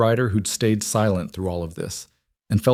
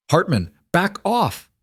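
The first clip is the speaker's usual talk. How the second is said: stern warning shout; 9 semitones higher than usual. A narrator's reading. Sheriff warning armed man.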